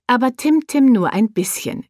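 plain reading voice

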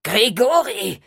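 high, loud vampire shriek; cry out. Acted. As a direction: shout the name in fury. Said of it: "Der Vampir schrie auf".

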